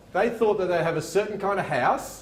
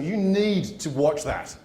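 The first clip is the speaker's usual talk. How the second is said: ordinary delivery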